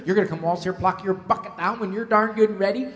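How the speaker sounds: ordinary talk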